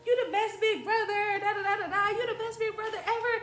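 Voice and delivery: high, excited retold praise; gushing little sister. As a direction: squeal thanks at a hero brother, playfully. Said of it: "hugging on him like".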